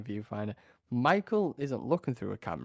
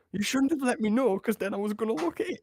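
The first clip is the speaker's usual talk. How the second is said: high exasperated complaint; 10 semitones higher than usual. Retold or imitated. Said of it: "And I was like".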